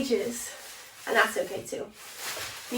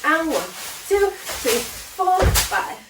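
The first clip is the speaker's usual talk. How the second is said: shouted count; 5 semitones higher, 5 dB louder, much slower than usual. Shown as a demonstration. Counting cheer routine aloud.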